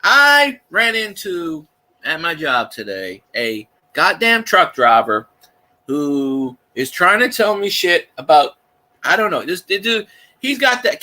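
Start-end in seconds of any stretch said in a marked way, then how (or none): none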